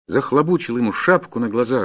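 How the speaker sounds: plain reading voice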